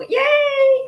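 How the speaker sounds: high, drawn-out mock cheer; long squealed yay; imitated cheer. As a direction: cheer like a fan at a concert. Mimics a cheering fan.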